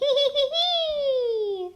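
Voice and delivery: a short bright laugh; light giggle. Real, not acted. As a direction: giggle at the funny word. Giggle after antennae.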